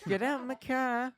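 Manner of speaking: high, slow hammed-up Irish; mock Irish farmer yelling about his car; mock Irish accent. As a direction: drag it out in a jokey Irish accent. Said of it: "accent humor".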